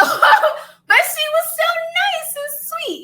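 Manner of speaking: high, slow, laughing praise; laughing fondly. Real, not acted. Praising the kind neighbor, high and laughing. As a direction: gush through laughter.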